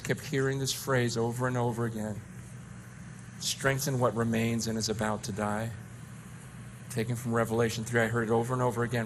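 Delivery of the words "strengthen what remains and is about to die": gentle reminder. Retold or imitated. Quoting the Lord's phrase.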